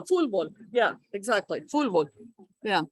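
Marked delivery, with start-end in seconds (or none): 0.0-0.5 "Fútbol" said "fool-bol"; L instead of T. Shown as a demonstration. Confirming a student's version, "Fútbol" with an L. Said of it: "with the L". 1.6-2.1 "Fútbol" said "fool-bol", lower in pitch; an L closing the first syllable of "Fútbol"; L instead of T. Shown as a demonstration. Confirming a student's version, "Fútbol" with an L. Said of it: "with the L".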